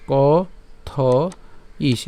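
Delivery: কথয়িষ very slowly in separate pieces, ko-tho-ish; drawn-out teaching pronunciation of কথয়িষ; syllable-by-syllable. Shown as a demonstration. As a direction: break up কথয়িষ and stop on the final sh. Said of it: "এই মূর্ধন্য ষ পর্যন্ত আমরা উচ্চারণ করছি".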